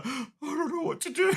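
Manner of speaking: high, helpless voice through a laugh; laughing mate impression. Mate stuck in the cab, laughing helplessly. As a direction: squeak it out, giggling and lost. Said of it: "my mate ... is there go".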